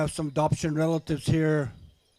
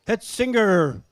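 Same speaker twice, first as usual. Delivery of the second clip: powwow emcee; 5 semitones higher, 7 dB louder, slower than usual. Acted. Emcee calls head singer.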